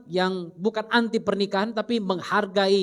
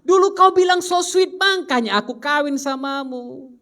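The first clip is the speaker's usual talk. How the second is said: mock girl's voice; 5 semitones higher, 4 dB louder than usual. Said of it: "Dia pikir".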